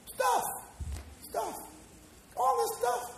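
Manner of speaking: squeaky high "Stuff"; high mocking voice; mocking squeal. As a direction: sneer at all the stuff in a thin high voice.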